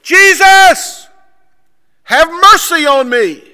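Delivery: full high-pitched shout for help; screaming. Retold or imitated. Blind man to Jesus over the crowd, screaming. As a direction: scream it as loud as possible. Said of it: "as loud as he can possibly scream".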